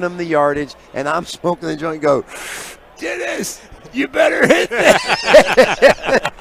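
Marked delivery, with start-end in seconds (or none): 3.0-6.3 high squeezed voice, louder and drawn out; strained high squeak. Retold or imitated. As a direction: squeal it as if holding in smoke. Acts himself mimicking Hopper's squeak.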